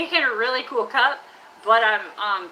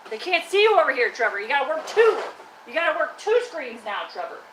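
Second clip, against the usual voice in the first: playful scolding; 2 semitones higher, 5 dB louder than usual. Pretend. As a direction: scold a trash can like a stubborn performer. Mock scolding of trash can Trevor.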